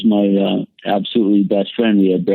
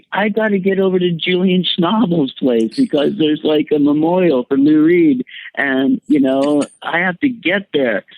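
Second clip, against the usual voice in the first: mock scream; 8 semitones higher than usual. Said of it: "like, screaming".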